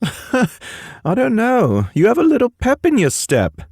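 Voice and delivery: high, far-off British voice; Pacey's British voice. Acted. Pacey ribbing Miles in a British voice. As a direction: voice Pacey as a light Brit.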